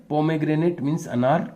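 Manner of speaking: ordinary talk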